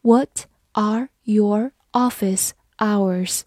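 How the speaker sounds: slow, deliberate read; slow classroom model question. Shown as a demonstration. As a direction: stretch each word for learners. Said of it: "好，我们慢慢来读。".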